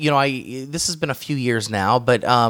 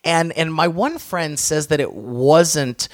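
ordinary talk, nothing marked

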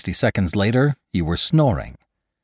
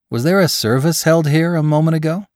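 higher, louder questioning voice; inquiring attendant; 9 semitones higher, 5 dB louder than usual. Acted. Attendant to a night visitor, inquiring. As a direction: ask it lightly and brightly raised.

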